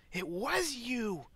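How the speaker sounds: high, slow, shocked wife; gasping accusation. Acted. Wife realising the guest broke the pot, gasping high. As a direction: draw it out in mock horror. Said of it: "kinda gasps".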